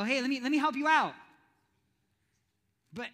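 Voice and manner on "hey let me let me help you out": quick, loud, overeager offer; a hint of a showy helper, louder; pushy mock helper. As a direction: push your help on them, too keen. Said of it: "or people go".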